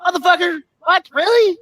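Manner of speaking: imitating his own stunned reaction; mock disbelief. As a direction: play up the disbelief. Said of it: "I'm like".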